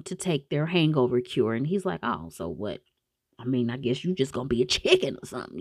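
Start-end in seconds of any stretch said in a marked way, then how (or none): 2.0-5.6 much lower, softer, quick taunt; lightly voiced smug boyfriend; taunting dare. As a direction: goad her into it. Slater taunting, voiced low.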